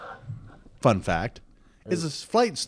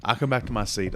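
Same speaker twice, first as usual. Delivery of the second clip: ordinary talk, nothing marked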